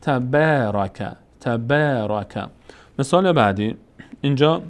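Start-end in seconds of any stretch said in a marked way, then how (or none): 0.0-2.5 تبارک drawn out with long aa; slow recitation. Shown as a demonstration. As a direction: read تبارک slowly as taught.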